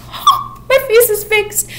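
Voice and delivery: high, airy gasp of wonder; hammed-up breathless joy; breathless awe. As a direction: marvel as if seeing a miracle. Waking from healing vision.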